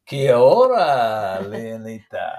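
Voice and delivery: put-on Kia ora in a slow Spanish accent. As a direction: greet her with a drawn-out Spanish accent. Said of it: "Spanish accent".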